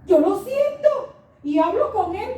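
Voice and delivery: loud, high testimony; fervent testimony. Real, not acted. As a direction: declare it to the congregation with fire. Preacher testifying with fervor. (testimonio fervoroso, fuerte)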